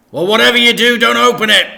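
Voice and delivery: loud, high exasperated dad; loud annoyed dad voice; grumpy dad. Acted. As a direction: grumble it loudly. Dad's voice.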